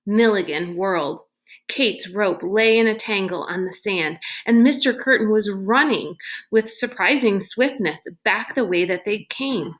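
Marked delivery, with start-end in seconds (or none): none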